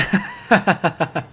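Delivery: long staccato chuckle; laugh. Real, not acted. Streamer greeting an arriving viewer, long laugh. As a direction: laugh out in a string of short bursts.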